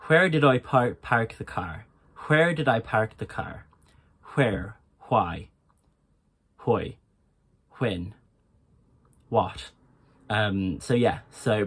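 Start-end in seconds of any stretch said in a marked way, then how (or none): 0.1-9.7 Irish accent with breathy "hw" on WH words. Shown as a demonstration. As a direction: breathe an H into each WH, with Irish R's. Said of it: "a sentence for that".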